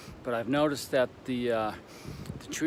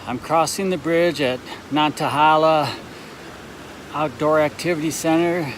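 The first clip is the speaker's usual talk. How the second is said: windy on a bridge; 4 semitones higher, 9 dB louder than usual. Real, not acted.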